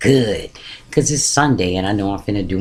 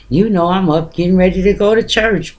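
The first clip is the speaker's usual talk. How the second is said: upbeat church morning; 9 semitones higher, 4 dB louder than usual. Retold or imitated. Telling friend she's getting ready.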